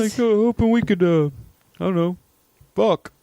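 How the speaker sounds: high, hesitant put-on voice; mock creepy client. Co-host plays client propositioning masseuse, high mock voice. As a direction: play a hopeful creep at high pitch.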